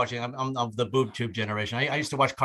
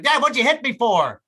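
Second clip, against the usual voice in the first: put-on indignant kid yell; 9 semitones higher, 6 dB louder than usual.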